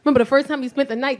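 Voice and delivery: ordinary delivery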